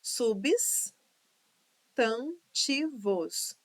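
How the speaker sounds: "Substantivos" very slowly as subs-tan-ti-vos; syllable by syllable. Shown as a demonstration. Teacher correcting the division, "Substantivos" syllable by syllable. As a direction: split "Substantivos" with long gaps, the B kept in the first piece. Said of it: "O B vem pra cá".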